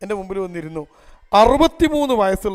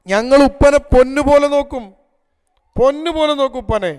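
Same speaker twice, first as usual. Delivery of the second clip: earnest plea; 6 semitones higher, 8 dB louder than usual. Retold or imitated. Children plead for their father.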